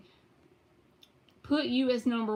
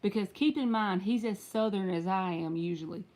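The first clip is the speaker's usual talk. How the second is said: usual speaking voice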